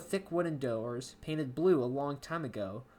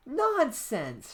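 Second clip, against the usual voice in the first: higher, lightly voiced brush-off; Amy dismissive; 10 semitones higher, 4 dB louder than usual. Amy brushes off John's excuse, lightly voiced. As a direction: wave away his excuse as Amy. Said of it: "she replied".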